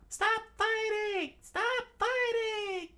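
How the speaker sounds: very high, drawn-out falsetto scream; stretched, pleading shriek to a crowd below; Princess Phoebe. Acted. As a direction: scream slowly in a put-on princess voice. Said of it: "Princess Phoebe screamed out".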